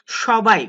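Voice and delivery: সবাই said with a sh sound, shobai; সবাই as shobai, loud and clear; teacher calls out সবাই with sh; pronunciation demo. Shown as a demonstration. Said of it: "এটার উচ্চারণ করবো আমরা তালব্য শ মতো".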